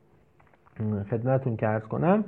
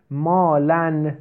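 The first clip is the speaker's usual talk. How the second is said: مالن drawn out very slowly, a bit louder; slow reading; 3 semitones higher, 4 dB louder, much slower than usual. Shown as a demonstration. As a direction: stretch مالن for the class. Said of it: "مالن شده". (مالن را کشیده بخوان)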